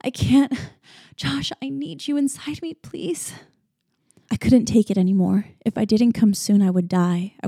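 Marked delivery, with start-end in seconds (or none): 0.0-3.3 desperate begging. A narrator's reading. Begging, near breaking.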